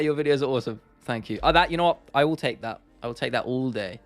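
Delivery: ordinary talk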